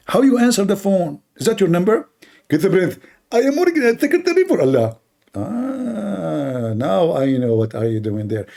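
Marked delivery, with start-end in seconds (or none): 2.5-6.7 high, smug preacher voice; Zakir Naik impression. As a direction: preen like a self-important cleric. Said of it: "So Zakir".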